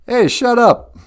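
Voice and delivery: high loud shut up; teasing high yell; mock shout. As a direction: yell it playfully. Telling off the critics.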